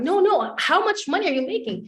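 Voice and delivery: high-pitched, impatient re-asking; insistent question. Retold or imitated. Woman brushing off his suspicion about earnings, insistent. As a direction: cut in and demand an answer.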